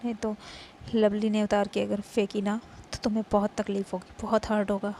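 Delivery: loud, firm threat to a rival; loud possessive warning; stern warning. Acted. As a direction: warn her off, hard and loud. Rival warns her off.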